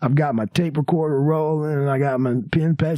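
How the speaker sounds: ordinary talk, nothing marked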